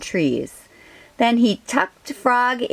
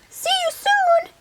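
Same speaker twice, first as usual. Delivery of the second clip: very high tiny mouse voice; little mouse voice. Acted. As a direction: squeak a quick goodbye.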